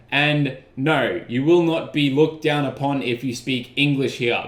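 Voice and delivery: ordinary delivery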